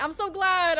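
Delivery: high, loud, gushing relief; delighted outburst. Real, not acted. Streamer hearing the viewer loves it, delighted outburst. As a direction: burst out with delight.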